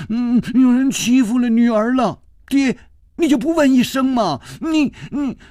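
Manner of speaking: high, whiny acted sobbing complaint; tearful voice. A narrator's reading. Daughter complaining to father that she was bullied, tearful voice. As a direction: plead in a high crying voice, breaking off at the end. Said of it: "施少奶奶带着哭音说".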